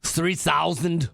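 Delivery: slow Austrian "Three thousand"; Arnold Schwarzenegger. Retold or imitated. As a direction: Austrian accent, slow and heavy. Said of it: "We were doing a pretty good Arnold there".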